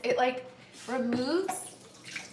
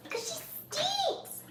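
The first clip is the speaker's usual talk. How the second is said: very high, slow little voice; drawn-out squeaky tease, held back in volume; softer pet tease; 4 dB quieter, slower than usual. Real, not acted. Repeats the tease more softly.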